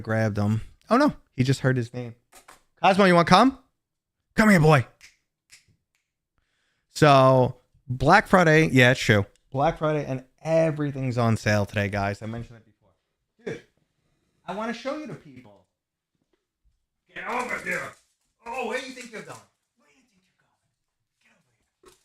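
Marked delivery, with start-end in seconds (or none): 2.8-4.9 high, loud call to the dog; bright high dog-calling voice. Real, not acted. 13.4-19.4 high, soft coaxing pet voice; playful high voice for the dog; pet talk. Real, not acted.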